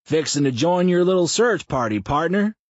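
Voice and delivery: a terrible fake Texan accent; bad Texan drawl. A narrator's reading. Mouth announces joining the search, bad Texan drawl. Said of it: "worst possible Texan drawl".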